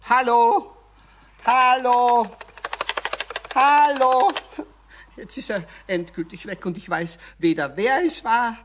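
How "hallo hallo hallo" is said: loud, rising calls into a dead line; calling into phone. Acted. Schladerer shouts into the dead telephone, loud. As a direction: shout hello, hoping someone answers.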